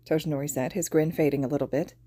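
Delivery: usual speaking voice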